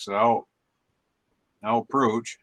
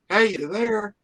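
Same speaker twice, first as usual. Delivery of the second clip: mock phone call; 10 semitones higher, 6 dB louder, much slower than usual. Joke about phone still in hand.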